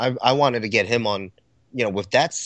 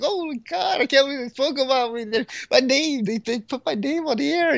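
high-pitched giddy gushing, hammed up; mock fanboy squeal, without extra volume. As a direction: gush like a starstruck fan in a squeaky high voice. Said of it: "I got all giddy about it".

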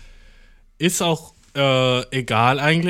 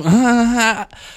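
wry laugh; 9 semitones higher, 5 dB louder than usual. Real, not acted. Laughing at own stress.

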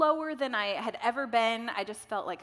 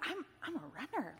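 hushed wonder; 4 semitones higher, 9 dB quieter than usual. Retold or imitated. Retold excited realisation of new identity.

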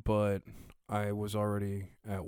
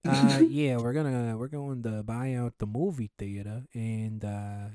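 slow, hammed-up Israeli accent; lightly put-on Israeli accent, slow; mock Israeli accent; 3 dB louder than usual.

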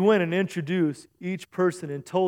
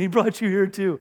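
ordinary talk

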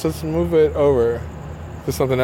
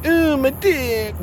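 high, slow, drawn-out Ooh of awe; 7 semitones higher, much slower than usual. Shown as a demonstration. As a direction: stretch the Ooh up high, slowly. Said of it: "I'm not like".

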